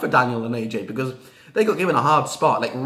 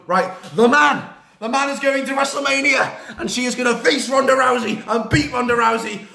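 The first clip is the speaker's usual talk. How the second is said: hyped; 8 semitones higher, 4 dB louder than usual. Real, not acted. Fan hyping her champion, laughing loud hype. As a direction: build the hype proudly.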